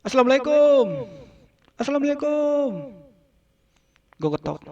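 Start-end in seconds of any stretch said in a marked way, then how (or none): 0.0-3.0 calling a greeting. Retold or imitated. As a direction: hail the house from outside.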